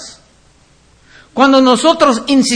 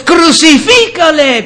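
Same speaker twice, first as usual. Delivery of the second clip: mock crowd yelling Crucifícale, higher and louder; preacher voices the mob calling "Crucifícale" with a raised voice; crowd cry; 5 semitones higher, 4 dB louder, slower than usual. Preacher retells the crowd before Pilate, a raised mock shout. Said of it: "volvieron a dar voces".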